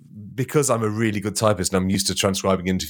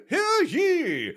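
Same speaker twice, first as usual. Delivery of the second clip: much higher, ringing crier call; town crier. Acted. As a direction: hear ye bellowed way up high. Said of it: "reenact it with you two".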